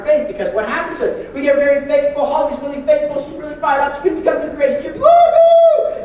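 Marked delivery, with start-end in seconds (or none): 5.0-6.0 high, loud whoop of joy; cheering woohoo. Retold or imitated. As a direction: whoop it up like a fired-up believer. Excited believer cheering.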